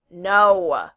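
loud, emphatic refusal. A narrator's reading. Kel stops Valoron's guess about Cleon, loudly. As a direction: cut them off with a big drawn "no". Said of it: "she said loudly".

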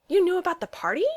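high-pitched, surprised question; shocked. A narrator's reading. Steve learns Loki knows about the party, shocked. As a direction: ask it in startled disbelief. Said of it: "Steve asked, shocked".

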